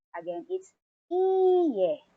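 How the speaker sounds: iie said slowly, E held long. Shown as a demonstration. Teacher repeating iie slowly for students. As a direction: draw out the E in iie. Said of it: "Longer pronunciation of the E".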